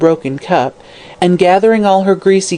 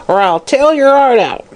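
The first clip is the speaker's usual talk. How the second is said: high, strained crone threat; croaking hag threat; 9 semitones higher than usual. Acted. Hag threatening the girl, high croaking menace. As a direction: snarl it like a witch. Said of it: "croaked the old hag".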